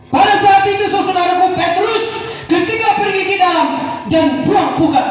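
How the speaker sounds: rally the couples to church; preaching at full force. Acted. Pastor urging husbands and wives, shouted exhortation. Preacher proclaims Peter story.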